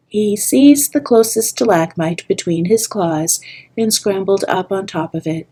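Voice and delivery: usual speaking voice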